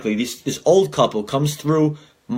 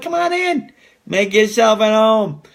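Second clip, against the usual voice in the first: very high, slow sing-song welcome; sing-song "come on in"; welcoming; 6 semitones higher, slower than usual. Retold or imitated. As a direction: draw out the invitation sweetly. Welcoming buyers again.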